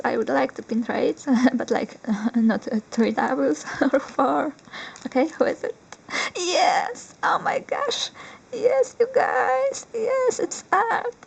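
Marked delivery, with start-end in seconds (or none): 6.4-11.1 high, drawn-out squeals of real delight; too excited. Real, not acted. Collector thrilled to pull Art from a pack, overexcited squealing. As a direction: squeal your yeses, stretching them out. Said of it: "Sorry, I'm too excited".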